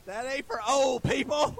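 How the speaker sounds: high, loud, slow shout in mock protest; playful shout. Real, not acted. Pastor defending AM radio to the congregation, playful loud shout.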